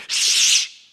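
sharp, forceful "shh"; loud shushing. Acted. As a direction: hush her urgently. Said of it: "And he's like".

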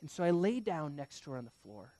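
ordinary talk

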